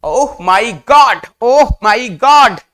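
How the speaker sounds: loud, slow, awestruck delight; loud admiring gasp of joy; delighted exclaiming. Real, not acted. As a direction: marvel out loud at something beautiful. Teacher marvels again.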